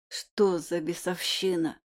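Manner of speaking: low, slow hoarse croak; hoarse wheeze. A narrator's reading. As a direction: rasp it out in pain. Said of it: "прохрипел я".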